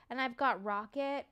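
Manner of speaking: ordinary talk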